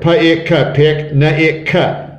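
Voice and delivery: Pa-ik-ka, pick, sound by sound. Shown as a demonstration. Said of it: "Look at this word".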